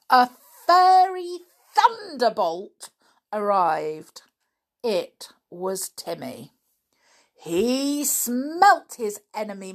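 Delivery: ordinary delivery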